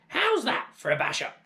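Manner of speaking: very high, loud hag voice; gloating crone shout. Acted. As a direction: crow down from the roof. Grandma gloating.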